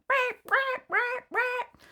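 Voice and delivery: high, loud, repeated alarm squawk; imitated car alarm. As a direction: blare it like a proximity sensor. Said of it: "the proximity alarms in the back were going".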